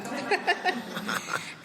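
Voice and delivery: bright amused burst of laughter; high unvoiced laugh. Real, not acted. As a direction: laugh at your own joke. Laughs at her own reply.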